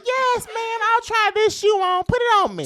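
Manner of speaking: high, loud mock-eager customer; eager shopper. Customer trying on a Gucci shoe, hammed-up eagerness. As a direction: gush loudly at the shop assistant.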